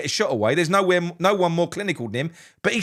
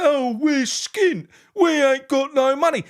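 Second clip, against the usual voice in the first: slow, higher mock whine of poverty; mocking owners' plea; 8 semitones higher, slower than usual. Fan mocking owners who plead poverty, whiny mock plea. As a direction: parody the owners pleading they're broke. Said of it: "they plead poverty and say".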